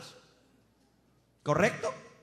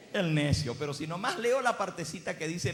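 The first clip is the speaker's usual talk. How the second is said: normal talking voice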